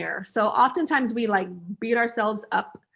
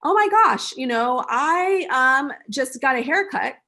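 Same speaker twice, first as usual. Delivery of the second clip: samer friend; 4 semitones higher, 5 dB louder, slower than usual. Retold or imitated. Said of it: "the samer friend is like".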